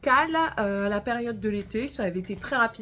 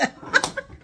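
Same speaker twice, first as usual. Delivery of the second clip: high, airy burst of laughter; real laugh; 3 dB louder than usual. Real, not acted. Vlogger amused by her own accent joke, high laugh. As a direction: crack up at your own joke.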